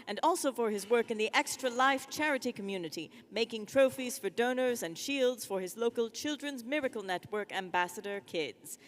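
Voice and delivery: ordinary talk, nothing marked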